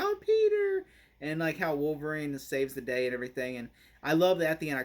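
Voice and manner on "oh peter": high, loud mock cry of delight; imitated gushing welcome; delighted greeting. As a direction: call out the name in a high squeal. Imitating characters greeting Peter.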